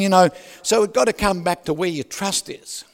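normal talking voice

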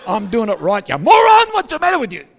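mock driver's road-rage outburst. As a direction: scream at the other driver.

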